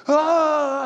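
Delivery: high loud startled yelp; mock startled scream; startled cry. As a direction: jump back in alarm. Disciples startled by leper.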